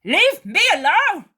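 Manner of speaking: high, loud rude snap; rude shout. Acted. Judas rudely shouting at Mary. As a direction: bark it at her. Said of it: "says Judas rudely".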